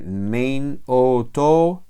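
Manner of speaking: slow, syllable by syllable endings; slow recital of endings. Shown as a demonstration. Teacher reciting Greek endings slowly. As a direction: spell out each ending slowly. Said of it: "estas son las mismas desinencias".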